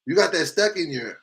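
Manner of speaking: ordinary delivery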